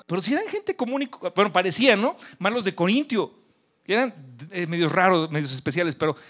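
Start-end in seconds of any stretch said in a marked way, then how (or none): none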